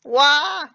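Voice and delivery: loud high sobbing wail; crying wail. Acted. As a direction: let out a loud wailing cry. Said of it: "Stop crying".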